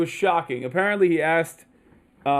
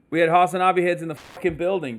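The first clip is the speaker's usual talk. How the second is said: usual speaking voice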